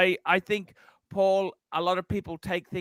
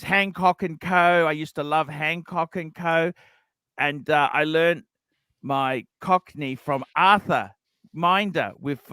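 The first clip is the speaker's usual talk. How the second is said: ordinary delivery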